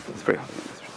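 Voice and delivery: soft, breathy whisper of the same line; hushed repeat. Shown as a demonstration. Repeats line, second time hushed.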